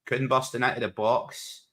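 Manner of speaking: usual speaking voice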